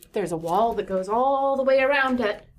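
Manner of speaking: usual speaking voice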